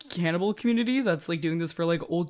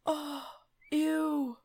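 high, grossed-out oh ew; real disgust; 10 semitones higher than usual. Real, not acted. Player grossed out by the body parts, high ew. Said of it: "I'm saying that with me, not as Maris".

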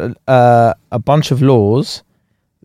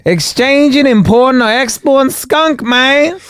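much higher, louder squeaky voice; squeaky literal official; 13 semitones higher, 3 dB louder than usual. Pretend. A super literal person scolding skunk sellers, squeaky high. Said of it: "Just went".

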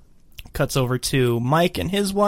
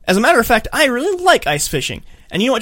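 mock indignant; 8 semitones higher, 4 dB louder than usual. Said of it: "And he's like".

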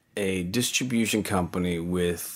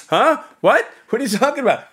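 squeaky high, louder innocent surprise; playing dumb; 8 dB louder, faster than usual. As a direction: feign total confusion, voice jumping up. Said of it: "And I go".

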